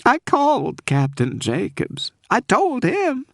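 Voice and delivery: holding back tears as she pleads; tearful Southern woman. Acted. Frightened caller begging the detective to save Cooper, tearful. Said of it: "I was sure she was sobbing".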